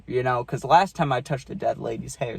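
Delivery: ordinary talk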